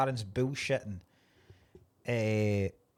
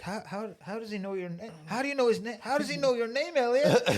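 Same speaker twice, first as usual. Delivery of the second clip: acted rising accusation; 7 semitones higher than usual. Film fan playing the boss questioning Elliot, building accusation. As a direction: imitate a suspicious boss working himself up.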